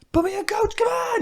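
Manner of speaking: high pleading mock plea to the coach; squeaky desperate please-put-me-in; begging benchwarmer. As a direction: beg the coach to let you play, voice cracking high. Player begging coach to play.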